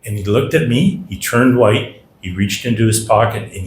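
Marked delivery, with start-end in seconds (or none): none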